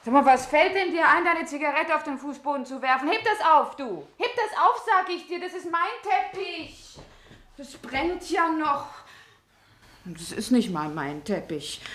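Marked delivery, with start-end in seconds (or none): 0.1-8.9 shrill, loud, much higher outrage; outraged shouting over her carpet; furious scolding. Acted. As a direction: yell at him to pick it up. Woman furious over dropped cigarette.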